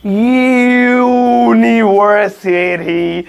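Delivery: slow, drawn-out university with the यू held; teacher slowing the word so the 'ya' sound is heard; slow motion. Shown as a demonstration. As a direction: stretch the यू at the start of university. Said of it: "slow motion बोलेंगे".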